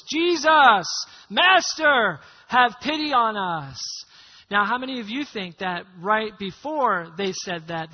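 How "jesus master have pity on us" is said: lepers calling out loudly from a distance. Acted. Ten lepers at a distance begging Jesus, loud call. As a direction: cry out to Jesus, drawn-out and loud. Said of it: "called out in a loud voice".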